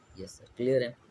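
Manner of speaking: lightly voiced class answering, low and soft; students' reply. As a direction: mimic the class saying yes sir. (धीमे से छात्रों का जवाब)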